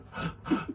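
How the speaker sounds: mimed gulp of air; gasp for breath. Retold or imitated. Retelling struggling through the smelly market, mock gasp for air. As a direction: imitate snatching a quick breath. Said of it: "catching breaths".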